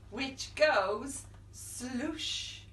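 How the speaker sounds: very slow sloosh; drawn out. Acted. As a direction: stretch sloosh out long. Sound word stretched.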